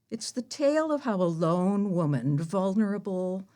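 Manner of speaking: normal talking voice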